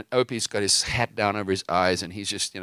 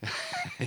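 short laugh; 10 dB quieter than usual. Real, not acted. Speaker laughs at timing.

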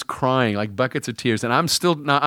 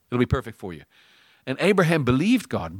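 normal talking voice

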